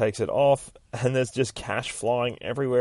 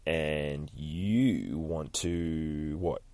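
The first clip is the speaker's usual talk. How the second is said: very slow, low, softer drawn-out prompt; slow, lowered, fishing for the answer; leading question; 5 semitones lower, 8 dB quieter, much slower than usual. Acted. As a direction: stretch each word out suggestively. Deadpool prompting her leadingly.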